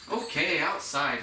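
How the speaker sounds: "outside" drawn out and pressed firmly; stress on outside. Shown as a demonstration. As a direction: land hard and slow on "outside", like a cue word for the dog. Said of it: "I'll start emphasizing the word outside".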